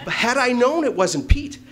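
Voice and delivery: high, loud, slow emphasis; emphatic. Real, not acted. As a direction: land the punchline hard. Emphatic punchline.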